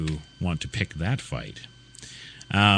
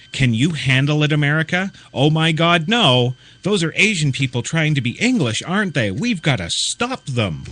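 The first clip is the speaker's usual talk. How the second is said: mock outrage; 6 semitones higher, 4 dB louder than usual.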